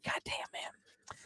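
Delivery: slow, breath-only whisper; hushed, drawn-out appreciation; whispered awe. Real, not acted. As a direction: let it out slowly under your breath. Awed reaction to a player pick.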